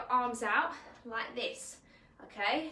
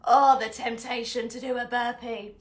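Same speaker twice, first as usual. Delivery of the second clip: mock temptation; 11 dB louder than usual. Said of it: "I'm like".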